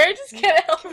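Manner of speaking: ordinary delivery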